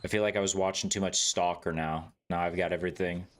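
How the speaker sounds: usual speaking voice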